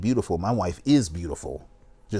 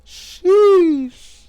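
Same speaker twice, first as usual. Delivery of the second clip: a long, high, loud drawn-out sheesh; jaw-dropped exclamation; stunned sheesh; 10 dB louder than usual. Real, not acted. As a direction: blown away, let it ring out. Stunned reaction to the clip.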